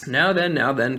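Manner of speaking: mildly hearty cabbie; steady everyone with a firm call. A narrator's reading. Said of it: "good, firm, hearty voice".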